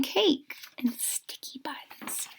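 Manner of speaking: slow whispered aside; hushed slow aside. Real, not acted. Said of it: "teller aside whispered".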